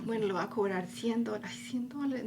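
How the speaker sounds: whispered nervous self-talk about the price; anxious whisper. Retold or imitated. Her inner voice fretting over charging a client, whispered. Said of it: "es como que".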